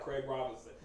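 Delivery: low, quiet imitation; Craig Robinson. Reading Jaco's text aloud, low imitated voice. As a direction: drop into a deep, soft voice. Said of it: "I can hear it in his voice".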